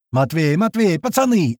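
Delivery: acted shout with restrained volume; yelling out. A narrator's reading. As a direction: call out to the lads, voice rising high. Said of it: "орём во всё горло".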